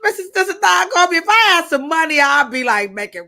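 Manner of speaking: high, loud, fast hype; loud high hype call; hyped. Real, not acted. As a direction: gush like you'd shower her with cash. Hyping up a viewer.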